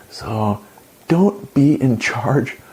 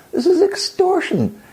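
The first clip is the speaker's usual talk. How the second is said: exasperated. Real, not acted. Ranting at feminist pledge.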